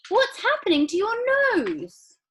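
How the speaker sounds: very high, loud panic; alarmed mother. A narrator's reading. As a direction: gasp at the nose. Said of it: "shrieked Missus Beauregard".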